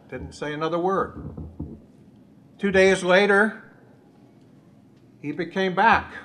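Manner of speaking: ordinary talk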